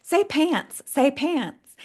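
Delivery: high, sing-song teasing from the cousins; mock childhood taunt. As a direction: goad a kid to say it funny. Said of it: "what they would always tell me".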